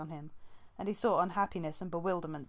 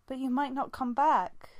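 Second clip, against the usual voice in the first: high, loud trembling plea; shaking voice; 7 semitones higher, 6 dB louder than usual. A narrator's reading. Leafpaw fearing her sister won't return, voice shaking. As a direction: let the fear break through. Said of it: "Leafpaw's voice shook".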